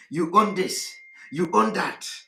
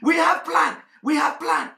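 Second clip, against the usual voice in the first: higher-pitched mimicked boast; mock insistence; 7 semitones higher, 3 dB louder than usual. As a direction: imitate activists insisting over and over. Mimics activists answering his question.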